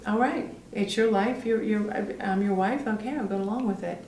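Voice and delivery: soft, understated giving in; quietly going along with it; resigned agreement. Acted. Wife finally accepting her husband's choice, resigned agreement. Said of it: "she finally does say".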